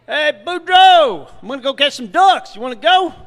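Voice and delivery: hollering to a friend from a boat; Thibodeau calling out. Acted.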